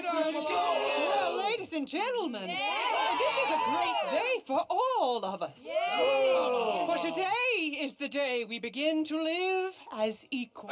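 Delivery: loud public address to a crowd; rousing speech. Acted. Suffragette addressing the crowd, loud and rousing. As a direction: project the speech over the street.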